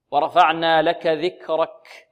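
ورفعنا لك ذكرك slow, air on each final ك; careful breathy kaf. Shown as a demonstration. Teacher modelling the verse, slow aspirated kaf. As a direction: recite slowly, breath flowing after ك in ذكرك. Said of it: "لا نقول ذكرك".

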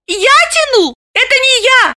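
high, indignant surprise rising to a shout; can't believe what she hears; astonished exclaim. Acted. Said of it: "Галина изумлённо воскликнула".

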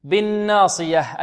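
بالناصية slowed, with a light نون and a heavy, emphatic ص; light nun, heavy sad. Shown as a demonstration. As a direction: draw out بالناصية to show the emphatic ص. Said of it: "النون ماذا؟ مرقة، والصاد ماذا؟".

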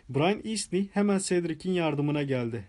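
usual speaking voice

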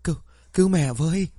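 high, fast panicked plea; a hurt mother pleading to her child for rescue; feeble plea for help. A narrator's reading. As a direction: beg weakly for help, frightened. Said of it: "lý nhí".

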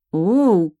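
loud surprised oh; exclamation. A narrator's reading. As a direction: gasp it out. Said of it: "воскликнула я".

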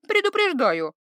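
high, firm word; high warning. Acted. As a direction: warn him. Iva warning.

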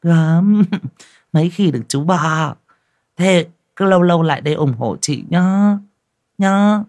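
high, giggly delight; gleeful. Acted. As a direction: gush with glee over the tip. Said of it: "hí hửng nhìn nó vui sướng".

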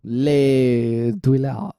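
drawn-out mock French accent, slow. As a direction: stretch it out like a silly French tourist. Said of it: "what do they call toilets".

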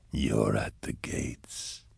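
low, very slow breathy whisper; eerie unseen voice. A narrator's reading. Demon voice greeting a man lost in dust, eerie whisper. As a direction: draw it out in a deep, soft whisper.

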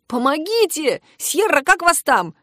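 mild high-pitched yell; crying for help. A narrator's reading. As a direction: call out for rescue, not at full volume. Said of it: "закричал".

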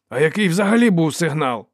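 high, loud baffled query; puzzled question. A narrator's reading. Dow asks what the signal even was, baffled. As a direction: ask it loudly, honestly at a loss.

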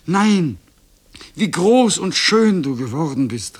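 high, loud motherly delight; joyful cry. A narrator's reading. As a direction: cry out in joyful amazement at your child. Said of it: "einen Freudenschrei ausstieß, das war die Mutter".

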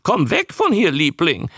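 high creature voice; high-pitched newt squawk; talking newt. A narrator's reading. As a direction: parrot an overheard visitor in newt voice. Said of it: "sagte der Molch plötzlich".

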